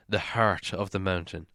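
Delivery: usual speaking voice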